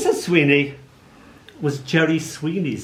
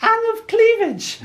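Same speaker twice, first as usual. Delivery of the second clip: very high, eager little schoolboy voice; nerdy kid; 3 dB louder, slower than usual. Retold or imitated. Nerd at the back answering the supply teacher, squeaky and eager. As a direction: pipe up like the keenest kid in class. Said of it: "little nerd at the back of the class pipes up".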